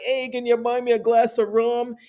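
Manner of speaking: slurred mock drunk, begging a drink; put-on tipsy voice; drunk John. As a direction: play a stumbling drunk cadging rum. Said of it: "drunk John who stumbles over like".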